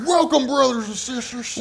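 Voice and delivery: high, drawn-out mock preacher greeting. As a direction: imitate a preacher welcoming the congregation. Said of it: "You sound like a preacher".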